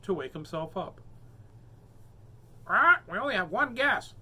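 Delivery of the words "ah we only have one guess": high, loud worried parrot; high, louder fretting parrot. Acted. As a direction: fret like an anxious bird.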